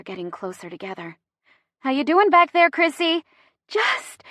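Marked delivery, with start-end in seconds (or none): 1.8-3.2 higher, louder call to the back seat; urgent check-in. A narrator's reading. Driver calls back to Chrissy in labor, urgent check-in. 3.7-4.2 single high, strained word; breathing through contraction. A narrator's reading. Chrissy answers mid-contraction in the car, breathing through pain. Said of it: "deep breath".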